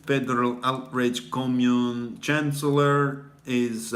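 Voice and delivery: ordinary talk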